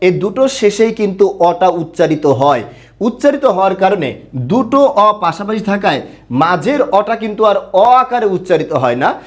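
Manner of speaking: normal talking voice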